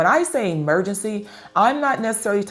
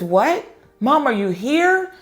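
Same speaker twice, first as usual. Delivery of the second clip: surprised, voice lifting; 6 semitones higher, 3 dB louder than usual. Retold or imitated. Higher, delighted disbelief. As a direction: pitch jumps up in happy surprise. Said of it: "his voice lifted up".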